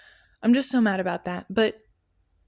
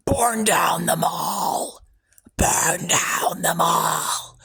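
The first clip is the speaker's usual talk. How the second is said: slow, breathy ghostly whisper of a command; eerie whisper; 3 semitones lower, much slower than usual. Shown as a demonstration. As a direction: hiss it slowly like a voice in your head. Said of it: "the words that were embedded into my head sounded like that".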